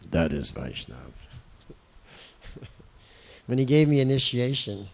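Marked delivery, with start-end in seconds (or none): 0.1-1.1 much lower, resonant, grave pronouncement; Prabhupada's deep voice. Retold or imitated. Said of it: "In his deep voice".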